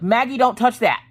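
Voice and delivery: put-on startled mother yelling at her child; loud yell. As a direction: blurt it out like you just woke with a start. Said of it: "just that loud".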